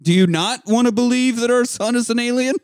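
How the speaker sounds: high, insistent question climbing to ALIEN; mock-incredulous rise through "our son is an alien"; questions-only voice. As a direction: press the question, pitch rising all the way to "alien". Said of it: "he's gotta talk to her in questions only".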